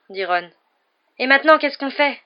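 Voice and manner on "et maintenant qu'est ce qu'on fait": despairing tone. A narrator's reading. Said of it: "d'un ton désespéré".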